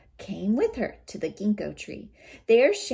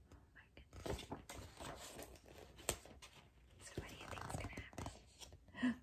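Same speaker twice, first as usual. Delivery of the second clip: soft whispered aside; 22 dB quieter, faster than usual. Real, not acted. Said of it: "aside to listeners".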